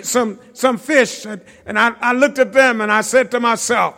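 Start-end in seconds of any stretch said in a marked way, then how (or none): none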